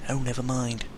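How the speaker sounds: ordinary delivery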